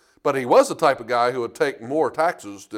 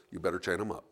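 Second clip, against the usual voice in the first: low warning; 8 semitones lower, 12 dB quieter, faster than usual. Retold or imitated. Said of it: "He goes".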